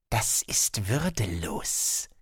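slow, low, cutting voice of a talking sword; like a knife slicing through silk. Acted. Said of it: "die wie ein Schnitzmesser klang, das durch Seide schnitt".

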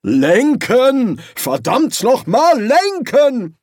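loud, high, drawn-out command; exasperated bellowed order; frantic shouting. A narrator's reading. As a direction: yell it furiously at the person steering. Frantic order on a runaway broom.

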